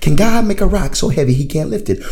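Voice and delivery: fast, as if thinking it through aloud; puzzled, rushed musing. Shown as a demonstration. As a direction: rattle it off, working out the riddle. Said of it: "And you start thinking about that".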